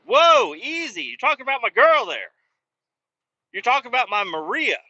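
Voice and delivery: loud, high-pitched pretend outrage; whooping, exaggerated "back off" warning; mock-protective outburst. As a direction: leap to her defence, hammed up. Mock defence of his friend.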